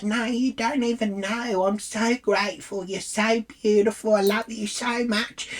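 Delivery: high-pitched mock-tearful gratitude; tearful gushing. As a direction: imitate an overwhelmed fan near tears.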